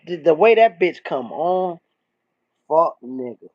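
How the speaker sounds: usual speaking voice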